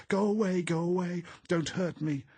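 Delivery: high, loud panicked screams; frantic nightmare shriek; wild shrieking. A narrator's reading. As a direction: shriek in terror, warding off harm. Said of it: "shrieking voice she had never heard before".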